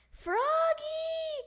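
long, high, loud sing-out of a name; calling out. A narrator's reading. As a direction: holler for a child across the house. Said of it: "called his mother".

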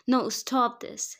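loud, slow, drawn-out plea; desperate pleading. A narrator's reading. Bride begs groom to stop counting, pleading. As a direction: beg loudly for it to stop. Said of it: "she pleaded with tears in her eyes".

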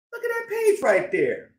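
ordinary delivery

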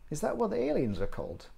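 put-on high-pitched child's question; young voice, pitched well up; curious daughter. Daughter asks about aliens.

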